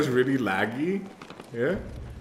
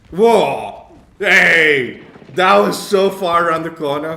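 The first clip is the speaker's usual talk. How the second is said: loud, surprised shout of protest; outraged shout; 6 semitones higher, 14 dB louder, slower than usual. Real, not acted. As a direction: yell in disbelief at being shot. Shot from around a corner.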